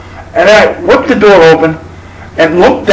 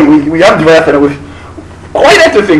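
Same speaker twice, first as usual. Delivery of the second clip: ordinary talk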